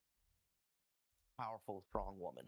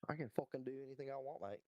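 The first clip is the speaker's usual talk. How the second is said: quick, put-on Aussie accent; Australian accent; 3 semitones higher, faster than usual. Boasting to friends about doing accents, Australian accent. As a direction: rattle it off in cocky Australian. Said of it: "you went Aussie on us".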